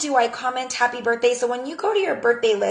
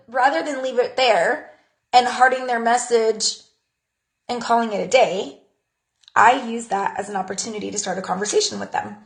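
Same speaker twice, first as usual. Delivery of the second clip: ordinary delivery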